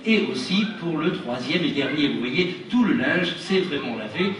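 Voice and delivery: slow, loud showman reveal; kids party magician. Acted. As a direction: present each clean hanky grandly.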